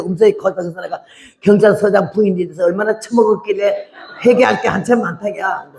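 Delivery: usual speaking voice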